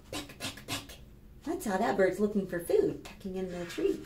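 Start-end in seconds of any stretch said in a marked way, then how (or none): 0.1-1.0 whispered pecks. Acted. Acting out bird actions.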